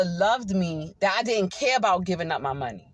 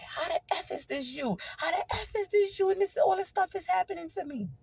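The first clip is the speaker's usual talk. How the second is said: high, hushed mock screaming; 8 semitones higher, 5 dB quieter than usual. Believer laid up at home questioning God, mock screaming. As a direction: retell an exasperated scream at reduced volume, pitched up. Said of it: "I would be screaming".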